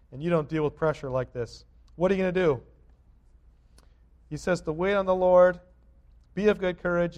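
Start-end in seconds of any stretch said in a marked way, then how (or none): 2.0-7.2 loud, rising sermon call; preaching at full force. Acted. Pastor urging the flock to courage, preaching loudly. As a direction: lift it like a preacher rallying the pews.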